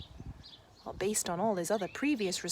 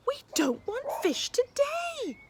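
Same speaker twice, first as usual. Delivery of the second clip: put-on high, childish huffy voice; a cross little boy; sulky kid; 4 dB louder, slower than usual. Ned to fisherman.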